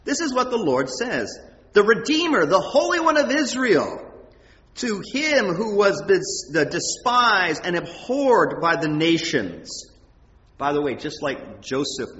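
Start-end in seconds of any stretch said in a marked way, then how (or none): 1.7-9.8 louder, higher declamatory reading; scripture proclamation. Acted. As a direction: proclaim it slowly and grandly to the congregation. Reads scripture aloud with force.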